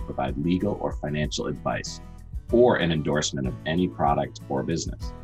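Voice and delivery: brisk legal disclaimer read; fast legal disclaimer. Acted. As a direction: rattle off the fine print. Disclaimer read over music.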